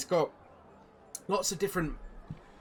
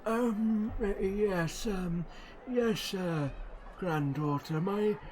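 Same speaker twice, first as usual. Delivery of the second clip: put-on old gnome Silas; 8 semitones higher, 5 dB louder, slower than usual.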